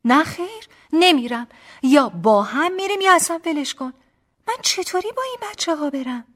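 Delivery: loud, high-pitched protest; indignant refusal. Acted. Acted quarrel, refusing to go. (اعتراض بلند و قاطع)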